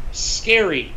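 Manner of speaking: ordinary talk, nothing marked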